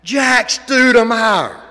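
high, loud booming call, drawn out slowly. Retold or imitated. NASCAR driver calls out to Jack from a big speaker, booming shout. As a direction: call his name across the crowd like over a loudspeaker. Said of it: "this booming voice says".

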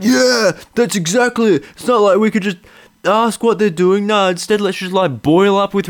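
high, loud mock-agreement; hammed-up bro in the crowd; mock dude agreeing. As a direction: play an eager audience guy. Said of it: "every dude watching is going like".

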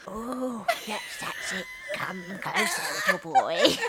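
high, slow, creepy lady voice with laughter; The Shrew voice. Retold or imitated. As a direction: coax it out like a creepy faceless lady.

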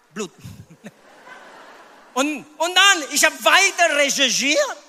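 ordinary talk

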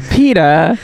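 nasal Lois calling Peter; Lois saying Peter. Retold or imitated. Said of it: "Lois saying "Peter".

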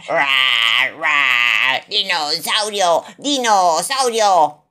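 slow, loud roaring dinosaur; little boy roaring as a dinosaur. A narrator's reading. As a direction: roar like a toddler playing dinosaur. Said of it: "ruge George".

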